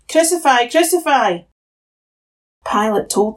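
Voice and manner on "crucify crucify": high-pitched, restrained crowd chant; a touch of frenzy, voice pitched up; mild frenzied shout. A narrator's reading. High priests and police seeing Jesus, understated frenzied shout. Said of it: "they shouted in a frenzy".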